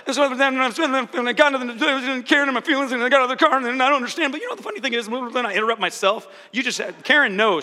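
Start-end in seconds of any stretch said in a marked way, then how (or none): 0.1-4.3 higher, louder rapid-fire rambling; rambling machine-gun talk. Retold or imitated. Scene: coach imitating herself rambling emotionally, higher breathless rush. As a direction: pour words out without a breath. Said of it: "I'm just like".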